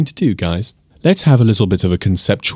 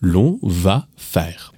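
very slow, careful French model; slow model phrase; much slower than usual. Shown as a demonstration. Teacher to students, slow, careful French model phrase. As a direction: spell it out slowly for learners. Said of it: "we will say".

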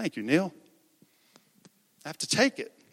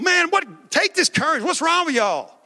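high, heated mock telling-off; worked-up, high-pitched rebuke; exasperated scolding; 10 semitones higher than usual. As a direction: scold them in disbelief. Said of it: "But that's not what he did".

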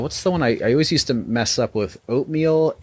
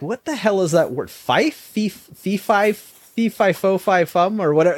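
higher-pitched mock confusion, imitating people stumbling on a word; mimicked bafflement, pitched up; baffled sounding-out; 8 semitones higher, slower than usual. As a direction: retell them fumbling for the pronunciation. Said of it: "they could not pronounce fiefdom".

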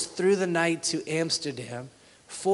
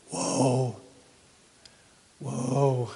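awed whoa; 3 semitones lower than usual. Real, not acted. Awe at the catch.

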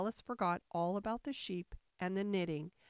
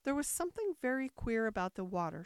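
ordinary delivery, nothing marked

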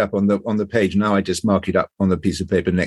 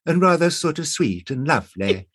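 high, sweet period English voice; sweet 1920s girl; 4 semitones higher than usual. Shown as a demonstration. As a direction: put on a lovely, ladylike girl's voice. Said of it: "she was talking like this".

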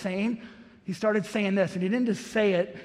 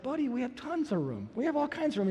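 softer, coaxing dad voice; calm the kid down gently; dad soothing his son about room; soft reassurance; 2 semitones higher, 7 dB quieter than usual. Retold or imitated.

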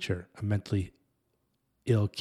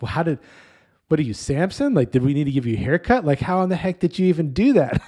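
loud, raised mock interrogation; baffled dad, pitched up and incredulous; playful disbelief; 6 semitones higher, 6 dB louder than usual. Joking disbelief at small son.

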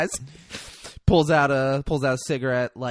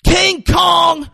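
high, loud imitated rant; full-blast movie villain tirade; Denzel Washington; 11 semitones higher, 10 dB louder than usual. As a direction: shout threats at the whole neighborhood. Yelling after crowd as Denzel.

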